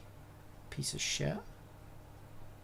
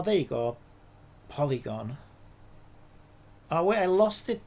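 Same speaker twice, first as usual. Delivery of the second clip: ordinary talk, nothing marked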